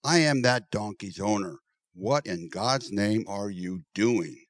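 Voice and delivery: a touch of indignant anger; lightly voiced angry owner. A narrator's reading. Owner confronting the men, mildly angry. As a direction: demand answers, slowing the end. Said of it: "his temper brewing".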